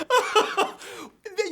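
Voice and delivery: high, loud burst of laughter. Real, not acted. Host laughs at Spanish.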